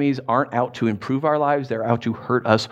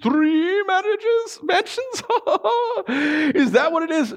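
very high, stretched squeal with laughter; giddy greedy excitement; 13 semitones higher than usual. Acted. Listener dreaming of mansions as reward, giddy squeal. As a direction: get carried away into a hammed-up gleeful squeal.

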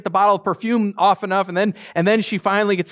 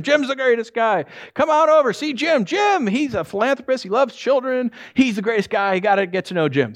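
higher-pitched, mock-enthusiastic gushing; gushing praise; 5 semitones higher than usual. Coworker urging others to meet Jim, gushing. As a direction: talk him up like an eager fan.

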